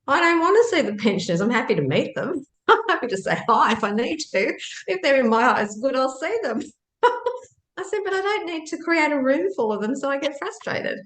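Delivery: high, giggly retelling of her own words; laughing while retelling. Retold or imitated. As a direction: laugh through the quote, voice high. Retelling her reply to husband, laughing.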